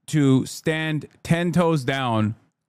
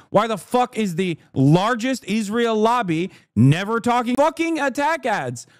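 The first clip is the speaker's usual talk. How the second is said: ordinary talk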